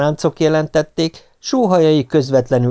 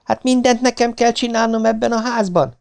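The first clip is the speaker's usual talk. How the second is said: high, exasperated complaint; indignant wifely scolding; 11 semitones higher than usual. A narrator's reading. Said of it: "förmet rám hitvesem".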